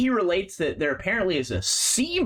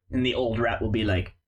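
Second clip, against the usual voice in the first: usual speaking voice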